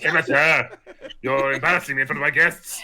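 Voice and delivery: tut-tut a guest like a posh host; posh scolding host. Pretend.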